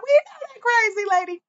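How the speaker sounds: high-pitched mock reply; imitated program staff. Host quotes programs recognizing her name, high imitation. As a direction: voice them well above your own pitch. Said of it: "they be like".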